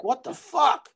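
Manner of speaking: loud, high retold exclamation; shocked outburst. Telling listener how he saw a stabbing, shocked outburst.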